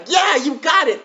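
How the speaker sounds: loud, high cheer; excited praise. Real, not acted. Teacher cheering the class for the right answer.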